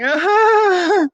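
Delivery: high, loud wondering cry; mock crowd gasp of awe; admiring gasp. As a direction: friends gasp at the coat. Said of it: "with his friends going".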